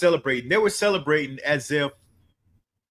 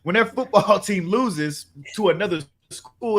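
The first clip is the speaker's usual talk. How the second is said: ordinary talk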